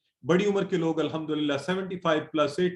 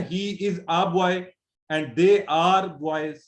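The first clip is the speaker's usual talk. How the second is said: slow English example; 3 dB louder, much slower than usual. Shown as a demonstration. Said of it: "हम English में भी ऐसे कहते हैं ना".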